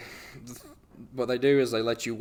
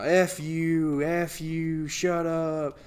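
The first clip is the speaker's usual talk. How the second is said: mock drunken yell; 6 semitones higher, much slower than usual. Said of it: "And she's screaming".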